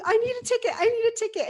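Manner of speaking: high, loud desperate begging; frantic plea. Retold or imitated. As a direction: beg the clerk for a ticket, high and pressing. Begging clerk in dream.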